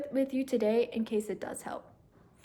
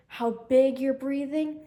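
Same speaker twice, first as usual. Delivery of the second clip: ordinary talk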